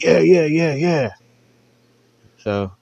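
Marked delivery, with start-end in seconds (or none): 0.0-1.1 quick eager high yeahs. Retold or imitated. Dudes eagerly agreeing to the note, high eager yeahs. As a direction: chime in all eager.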